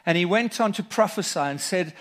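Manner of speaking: normal talking voice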